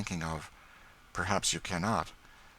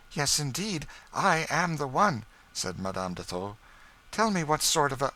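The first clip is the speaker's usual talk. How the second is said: higher, eager; Madame Desault; 8 semitones higher, 3 dB louder than usual. A narrator's reading. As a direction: rush to ask about her brother.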